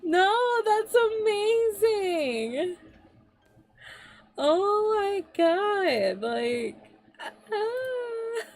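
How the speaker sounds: slow, high, choked-up awe; moved to tears by the fan art; touched, near tears. Real, not acted. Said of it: "I'm crying".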